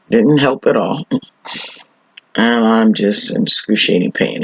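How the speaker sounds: usual speaking voice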